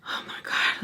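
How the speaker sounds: hushed, voiceless exclamation; breathless whisper. Retold or imitated. Woman searching for her missing friends, whispered panic. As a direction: whisper it to yourself in disbelief.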